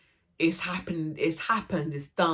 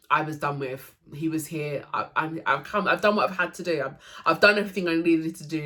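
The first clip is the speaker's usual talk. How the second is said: normal talking voice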